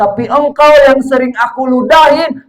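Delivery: suara tinggi sambil tersedu-sedu; menangis tersedu. Acted. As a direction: meratap haru sambil menangis. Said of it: "nangis, terseduh-seduh". (menangis tersedu-sedu karena terharu)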